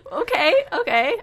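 high giggle into okay okay; laughing. Real, not acted. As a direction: laugh it off lightly. Laughing after sad summary.

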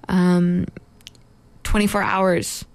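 ordinary talk

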